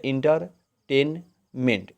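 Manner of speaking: Entertainment slowly in three beats, inter, टेन, meant; entertainment chopped in three parts for a class; three separate syllables. Shown as a demonstration. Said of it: "तीन बार बोला जाएगा".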